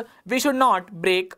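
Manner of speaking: very slow, word by word; unhurried teacher dictation. Shown as a demonstration. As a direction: read the example slowly for students to copy.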